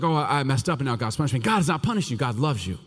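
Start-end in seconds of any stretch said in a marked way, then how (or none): 0.0-1.4 mock self-pity. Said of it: "So don't be like".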